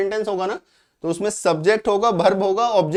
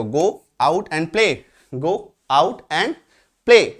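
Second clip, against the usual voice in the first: slow dictation; much slower than usual. Shown as a demonstration. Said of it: "बाहर जाओ और खेलो".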